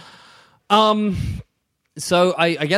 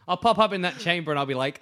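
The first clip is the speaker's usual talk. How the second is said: ordinary delivery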